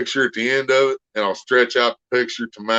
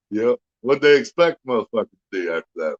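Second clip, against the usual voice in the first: ordinary talk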